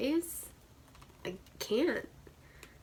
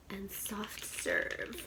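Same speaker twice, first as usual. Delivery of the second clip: whisper; 6 dB quieter, much slower than usual. Real, not acted. Reading shade names softly.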